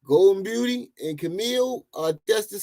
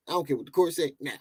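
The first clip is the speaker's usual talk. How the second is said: ordinary delivery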